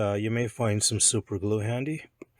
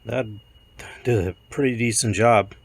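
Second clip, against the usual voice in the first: hoarse croaky voice from a cold; croaky, a bit sick. Real, not acted. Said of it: "ignore the, uh, croaky voice".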